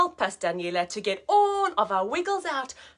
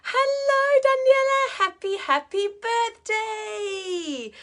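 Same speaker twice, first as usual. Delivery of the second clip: much higher little puppet voice; high puppet voice greeting the birthday girl; puppet Eddie voice; 9 semitones higher, 4 dB louder, slower than usual. Pretend. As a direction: speak for the puppet friend, high and sweet. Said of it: "Here he comes".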